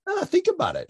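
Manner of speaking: light, high-pitched Australian imitation; Australian accent. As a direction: attempt a touch of Australian accent. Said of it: "I won't do his accent".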